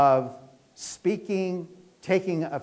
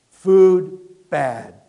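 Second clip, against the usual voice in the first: sudden loud food bad; monk's punchline; 11 dB louder than usual. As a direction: blurt it out after years of silence. Monk's two words punchline.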